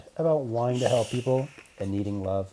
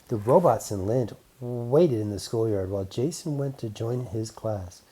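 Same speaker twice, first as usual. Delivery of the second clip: plain reading voice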